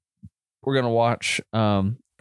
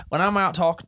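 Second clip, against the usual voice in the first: country accent attempt pitched higher, overshooting; reaching for his country accent but landing much higher; country accent, too high; 8 semitones higher than usual. Shown as a demonstration. Podcaster retrying his country accent for his co-host, pitched too high. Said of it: "my voice doesn't go that high".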